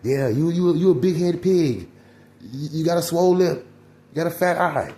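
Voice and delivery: high, loud jeering taunts; mock name-calling. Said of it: "you calling them certain stuff".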